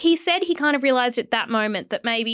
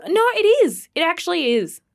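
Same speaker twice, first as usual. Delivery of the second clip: higher and louder, insisting it's funny; excited insistence; 8 semitones higher, 5 dB louder than usual. Real, not acted. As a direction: protest brightly that it is funny.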